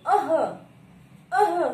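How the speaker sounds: अह with a breathy ह from the throat; visarga sound demo. Shown as a demonstration. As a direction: push the अह out from the throat. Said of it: "जैसे उच्चारित करके देखिए आप". (कंठ से ह जैसा अह बोलिए)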